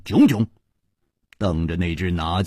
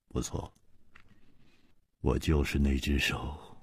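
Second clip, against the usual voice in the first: eerie chuckle, then low, hushed menace; sinister chuckling villain; 5 semitones lower, 6 dB quieter than usual. A narrator's reading. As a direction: gloat softly in a deep, sinister undertone. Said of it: "阴森森地笑着说". (阴森森地低笑着说)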